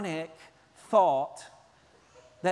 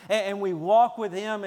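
usual speaking voice

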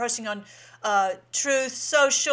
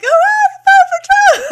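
much higher and louder squealed mock shout; imitating her high voice; 17 dB louder than usual. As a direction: squeal it way up like the excited lady. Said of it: "how she can get her voice that high".

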